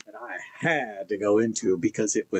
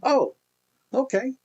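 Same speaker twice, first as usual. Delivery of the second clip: very high surprised oh; flustered high reaction; high startled okay; 14 semitones higher than usual. Retold or imitated. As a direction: squeak out an awkward okay. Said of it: "And I said".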